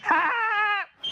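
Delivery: rabbit's pleased high giggle; high squeaky giggle. Acted. Rabbit laughs after line.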